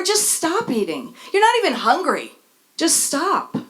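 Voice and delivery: hammed-up pushy friend; mock bossy advice.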